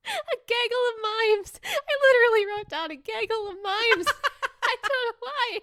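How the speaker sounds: very high, squeaky laughing speech; helpless laughter. Real, not acted. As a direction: squeeze the words out through giggles. Cracks up at own writing.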